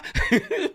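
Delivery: squealing burst of laughter; real shrieking laugh; high laugh. Real, not acted.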